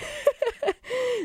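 a quick high giggle; real amused giggle; short laugh. Real, not acted. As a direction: laugh it off lightly. Host laughs mid-sentence.

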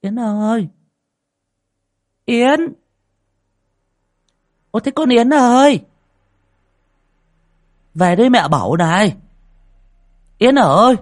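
high, loud call for a daughter. A narrator's reading. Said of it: "đoạn cất tiếng".

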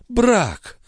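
high, indignant exclamation; high scornful cry; growing heated. A narrator's reading. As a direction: spit the word out in outrage. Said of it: "всё более распаляясь".

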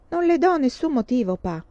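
put-on a girl insisting firmly; resolute. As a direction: stand your ground with pa. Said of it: "disse Laura risolutamente".

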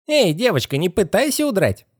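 high, creepy squeaky warning; squealing skeleton head. Acted. Skeleton stopping the girl's escape, high squeal. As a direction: warn them in a squeaky creature voice.

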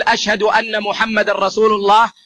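ordinary talk

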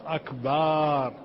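"أكبر" said slowly with a long ba, falling; stretched ba. Shown as a demonstration. Teacher showing how common people stretch the ba. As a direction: draw out the ba in "أكبر" like "akbaar". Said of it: "يمد الباء".

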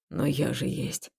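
low, soft, slow and sad. Acted. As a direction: say it quietly, hurt. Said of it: "ответил он грустно".

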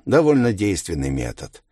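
ordinary delivery, nothing marked

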